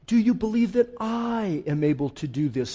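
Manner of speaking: "I" stretched out and emphasized; stress on I. Shown as a demonstration. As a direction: lean long on the word I. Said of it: "where God puts the accent".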